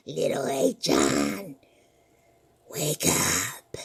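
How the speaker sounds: low, very slow spooky call; deep slow haunting summons; ghostly call. Acted. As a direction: draw the name out like a ghost. Ghost calls the boy.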